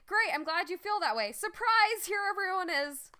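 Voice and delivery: spring the surprise with hammed-up delight; playful villain's ta-da, pitch climbing high; mock gleeful surprise. King springs surprise on queen.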